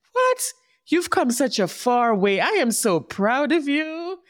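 high, thrilled burst of pride; proud cheer. Real, not acted. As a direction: gush over how far she has come.

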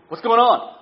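high, loud annoyed dad; mock dad yell. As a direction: bark it at the kids. Dad yelling again.

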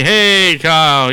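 normal talking voice

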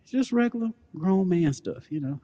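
put-on voice. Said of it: "Why your voice keep changing?".

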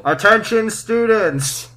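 hammed-up public address; school PA announcement. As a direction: announce it grandly to the whole school. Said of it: "announcing it to the school".

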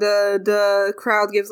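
ordinary talk

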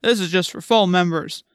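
gruff, officious warning; stern policeman voice. A narrator's reading. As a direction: warn off an intruder gruffly. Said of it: "the policeman said gruffly".

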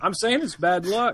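high, laughing through it. Real, not acted. Narrator explaining his joke to his co-host, laughing. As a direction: say it while cracking up.

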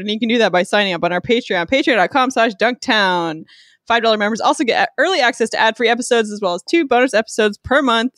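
rapid-fire ad read; fast read. Real, not acted. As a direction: rattle it off fast. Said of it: "That was so fast".

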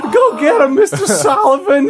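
high, loud squeaky cheerleading; intern monster. Acted. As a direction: cheer on the boss in a cracked squeal. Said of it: "like, intern monsters from Monsters, Inc".

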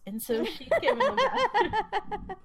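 can barely get it out for laughing; giggling helplessly while telling it; laughing through words. Real, not acted. Laughing while recalling bathroom scene.

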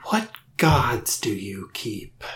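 slow eerie whisper of a creature; spooky whisper. Acted. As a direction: breathe it out slowly, menacing.

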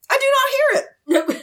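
very high, hammed-up refusal; Wei Wuxian. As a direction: squeal it in a put-on, dramatic voice. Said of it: "Wei Wuxian's like".

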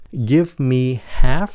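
"Give me half" run together, slow and linked; linked sounds. Shown as a demonstration. Teacher showing linking in "Give me half". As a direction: blend "Give me half" into one flow. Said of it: "nói âm luôn".

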